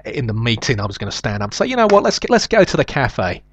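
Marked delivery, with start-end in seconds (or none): 1.6-3.4 high, breezy put-on casualness; mock casual suggestion. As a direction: sound spontaneous, like it just occurred to you. Acting out his own staged suggestion.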